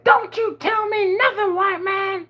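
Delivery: high, slow mimicked screech; angry woman's retort. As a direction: imitate an outraged mother telling someone off. Acts out angry mother snapping back.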